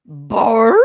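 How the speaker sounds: a loud brr then a high crying laugh; loud shiver and laughing cry. Real, not acted. Daughter cringes watching Zoe on stage, shudder and laugh. Reacts to awkward scene.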